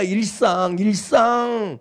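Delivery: 일상 repeated, drawn out very slowly; slow, weighty repetition; slow emphasis. Shown as a demonstration. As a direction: linger on 일상 and let it sink in. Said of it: "repeats word slowly for emphasis".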